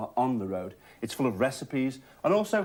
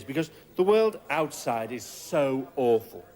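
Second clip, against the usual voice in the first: ordinary delivery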